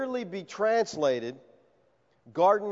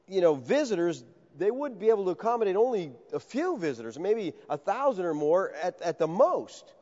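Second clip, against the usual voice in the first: normal talking voice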